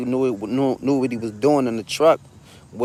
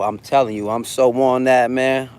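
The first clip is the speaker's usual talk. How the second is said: normal talking voice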